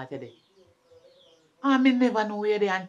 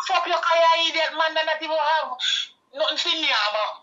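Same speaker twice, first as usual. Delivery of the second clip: angry rant; 7 semitones higher than usual. Acted. Mother furious at idiot son-in-law.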